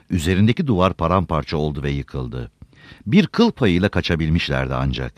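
plain reading voice

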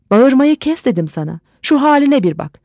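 mild scolding. Acted. Wife tells drunken husband to stop yelling, mildly scolding.

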